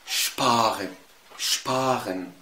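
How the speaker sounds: شبارن بشين تقيلة في الأول; انطق شبارن بشين تقيلة; heavy sch. Shown as a demonstration. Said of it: "نرجع لأول مثال". (نطق ألماني لشبارن بشين تقيلة)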